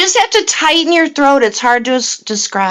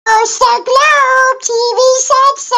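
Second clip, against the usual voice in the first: much higher, slow sing-song squeak; high cartoon voice; 10 semitones higher, slower than usual. Friend squeaks a joke line in his high cartoon voice. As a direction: chirp it like a tiny cartoon critter. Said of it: "That was just kind of a high voice".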